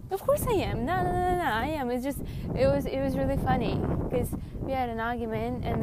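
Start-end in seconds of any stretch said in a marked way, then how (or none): 0.1-1.9 high, loud playful admission; emphatic backtracking. Real, not acted. Telling viewers she is like this, emphatic backtracking. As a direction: burst out higher and louder, taking it back. Jokingly takes back her refusal.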